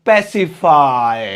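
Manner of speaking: Pacify drawn out slowly, each syllable clear; slow pronunciation demo. Shown as a demonstration. Teacher showing students how to say pacify, slowly. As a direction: say pacify slowly for the class to copy. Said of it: "इसे बोलने का simple तरीका है".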